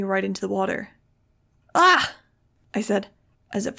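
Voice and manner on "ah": sharp high, loud yelp of surprise; shocked shout; startled cry. Acted. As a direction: cry out as you're knocked into water. Shoved into the sewer water.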